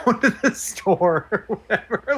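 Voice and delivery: high, laughing through it. Retold or imitated. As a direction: giggle through the words. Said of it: "when Ramona goes".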